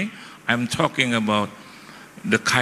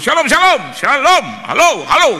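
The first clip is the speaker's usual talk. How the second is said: very loud, high calls of shalom and halo; shouting into the phone; 8 dB louder than usual. Retold or imitated. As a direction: yell like the line is about to cut out. Outside shouting into weak phone.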